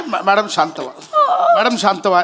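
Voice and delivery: calm her down gently; hammed-up comforting the widow; soothing. Consoling the crying widow.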